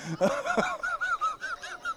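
high, wheezy laughing fit; long squealing laugh; helpless laughter. Real, not acted. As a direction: crack up at your own story. Cracks up at own story.

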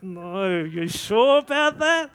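can't believe what he's hearing; squeaky doubting question to God; incredulous. Retold or imitated. Disbelieving God's instruction.

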